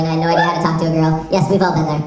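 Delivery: usual speaking voice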